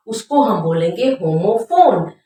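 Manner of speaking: normal talking voice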